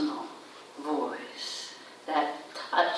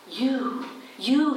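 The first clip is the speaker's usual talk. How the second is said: second you lifted higher and louder, pointed; individual focus; 6 semitones higher, 3 dB louder than usual. Shown as a demonstration. Preacher singling out one listener, leaning into each you. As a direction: say it straight to one person, you, you. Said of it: "with that individual focus".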